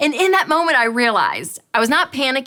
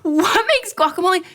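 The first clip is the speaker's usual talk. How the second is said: incredulous; 9 semitones higher than usual. Retold or imitated. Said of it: "I was like".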